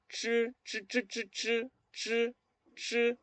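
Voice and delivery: "chi" with tongue bent back on the hard palate; curled-tongue ch. Shown as a demonstration. Teacher showing learners the sound, retroflex "chi". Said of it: "este sonido".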